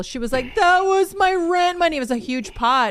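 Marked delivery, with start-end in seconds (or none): 0.6-2.0 higher, louder, slow mock wail; imitating crying woman. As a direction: ham up a woman losing her rent. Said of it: "cry at the table".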